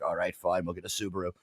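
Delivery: quiet, defeated father; defeated sigh. Retold or imitated. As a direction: give in softly, disappointed. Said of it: "He's like".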